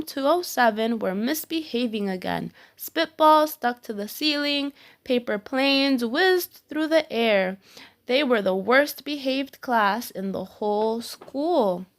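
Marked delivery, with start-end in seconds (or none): none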